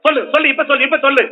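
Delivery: ordinary talk, nothing marked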